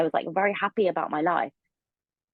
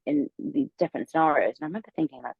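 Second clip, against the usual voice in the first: ordinary talk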